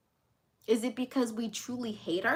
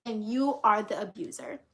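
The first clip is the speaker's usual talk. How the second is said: normal talking voice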